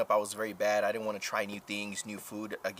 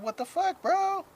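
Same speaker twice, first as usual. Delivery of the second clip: much higher, louder indignant squeal; outraged disbelief; 5 dB louder, slower than usual. Real, not acted. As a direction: shriek in betrayed disbelief. Outraged at Joseph, no dinner.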